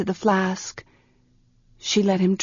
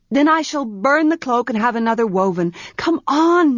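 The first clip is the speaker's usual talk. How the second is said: put-on higher, urgent young priestess; a young priestess pressing for an answer; Arha's voice; 4 semitones higher than usual. Young priestess Arha urgently asks her servant for a room.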